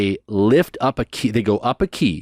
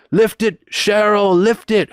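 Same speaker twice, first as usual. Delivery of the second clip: high, loud call to the organist; imitated preacher uncle; 10 semitones higher, 4 dB louder than usual. Preacher uncle calling the organ player to lift the key. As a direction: push the organist to raise it. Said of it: "you'll hear my uncle say".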